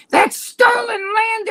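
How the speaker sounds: high mocking yell; mock shout. Host parodying protesters, high mock shout.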